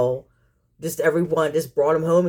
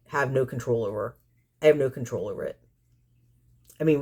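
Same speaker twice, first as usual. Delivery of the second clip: ordinary talk, nothing marked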